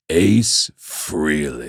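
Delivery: very slow, low name drop; slow deliberate delivery. Retold or imitated. Delivers the punchline name on stage.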